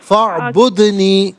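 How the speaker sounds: فَاعْبُدْنِي slowly with a clear ع and no u before n; corrected word. Shown as a demonstration. Teacher corrects caller's recitation slowly. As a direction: model فَاعْبُدْنِي slowly, ain sounded. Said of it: "teacher corrects caller's فَاعْبُدُونِي".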